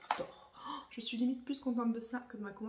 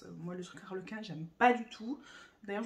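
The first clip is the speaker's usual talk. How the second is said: ordinary talk, nothing marked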